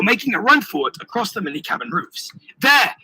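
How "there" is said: loud, high one-word shout; yelled. A narrator's reading. Hero spotting the Earl on the roofs, yelling. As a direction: call out the sighting sharply. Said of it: "I yelled, pointing".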